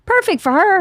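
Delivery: high emphatic praise. Real, not acted. To her friend about her daughter, high emphatic praise. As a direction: sell it as the perfect fit.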